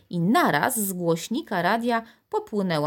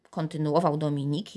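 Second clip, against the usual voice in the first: usual speaking voice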